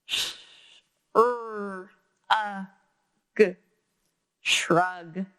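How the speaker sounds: Sh-, er, uh, g said one by one, then shrug; sounds pulled apart. Shown as a demonstration. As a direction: segment the word sound by sound. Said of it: "So we can pull that sound apart".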